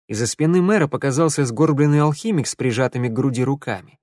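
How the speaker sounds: usual speaking voice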